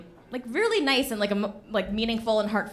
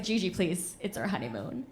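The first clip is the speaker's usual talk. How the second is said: soft pleading husband; 3 semitones lower, 7 dB quieter than usual. Retold or imitated. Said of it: "Patrick's like".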